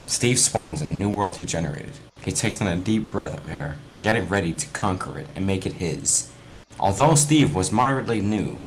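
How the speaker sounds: a lightly dramatic reading of a spooky fanfic; story narration. Acted. Reading fanfic aloud to gaming friends, storyteller voice. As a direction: read it like a campfire tale, lightly.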